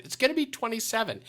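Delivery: high, timid price reveal; nervous price reveal. Retold or imitated. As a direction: barely get the number out. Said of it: "that's what I said".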